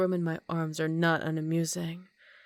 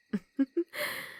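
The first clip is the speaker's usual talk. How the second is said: teasing laugh; 8 semitones higher than usual. Acted. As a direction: tease the boy no one will save. Vampire teases trapped captive.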